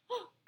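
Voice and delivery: sharp frightened gasp; startled gasp. Acted. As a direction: gasp in alarm. Fright at wind.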